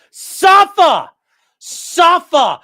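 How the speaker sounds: loud, high mocking shout; loud gloating cry; gloating yell. Real, not acted. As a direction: roar it in triumph. Gloating at losing judge.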